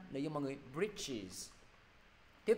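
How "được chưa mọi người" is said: normal talking voice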